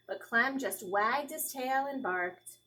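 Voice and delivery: usual speaking voice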